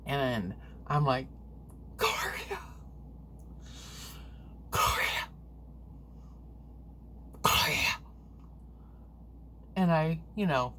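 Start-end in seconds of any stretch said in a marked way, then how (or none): 2.0-2.6 a sudden high squeak of a name; high-pitched call. Retold or imitated. Calling to wake the wife. 4.7-8.0 urgent whispered calls of her name; whispered calling. Retold or imitated. Trying to wake sleeping wife.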